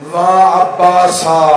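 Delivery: loud, slow drawn-out mourning cry; crying wail. A narrator's reading. Women mourning the martyrs after release, loud slow wail. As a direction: stretch the name out in a loud wail of grief. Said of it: "रो रो के कह रही थी".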